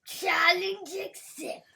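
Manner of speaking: slow, loud villain voice; evil voice. Pretend. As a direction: declare it like an unhinged villain. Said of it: "that's my evil voice".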